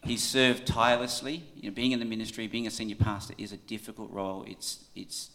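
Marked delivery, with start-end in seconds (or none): none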